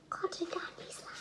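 whispering cotton candy slime softly; whispered. Shown as a demonstration. Kid whispers the slime name to viewers. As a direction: hush it to the camera. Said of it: "whispered repeat".

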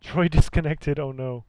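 loud, high alarmed call; alarmed shout. Real, not acted. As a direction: shout it out in dismay. Player drops from game.

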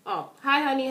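ordinary talk, nothing marked

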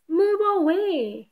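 hammed-up sheep yelling from the jeep; mild storybook yell. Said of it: "he yells with a beep".

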